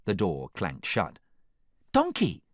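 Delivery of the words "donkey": high, startled call of recognition; excited cry. A narrator's reading. Fiona calls to her friend, crying out high. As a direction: cry out the name, pitched high. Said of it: "cried Fiona".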